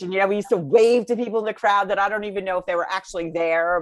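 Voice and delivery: ordinary delivery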